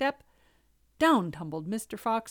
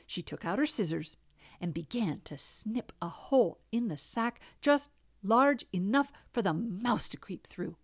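usual speaking voice

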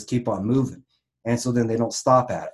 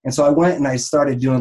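ordinary delivery

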